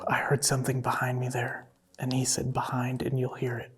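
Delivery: hushed, voiceless whisper; startled whisper. Real, not acted. Startled, whispering what was just heard.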